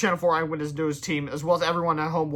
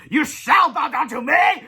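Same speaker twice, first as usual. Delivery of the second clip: slow, loud, high commanding yell; villain yell; 10 semitones higher, 7 dB louder, slower than usual. A narrator's reading. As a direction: yell it down at him slowly. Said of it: "Big Melvin yelled".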